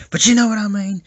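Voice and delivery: loud, high burst; emphatic insistence. Acted. Critic brushes off his apology to viewers, loud insistence. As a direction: push it out insistently, pitch jumping up.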